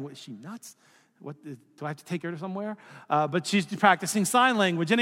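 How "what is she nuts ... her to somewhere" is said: quick, much softer private aside; muttered inner thought. Retold or imitated. Husband's bewildered thought watching his wife sign, quick and soft. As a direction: say it fast under your breath, bemused.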